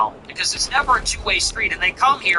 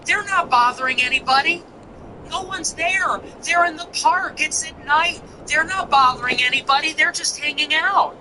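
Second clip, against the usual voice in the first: higher, mock-earnest defence; mocking Sneako; 7 semitones higher than usual. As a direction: parody a pleading apologist, pitched higher. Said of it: "And Sneako goes".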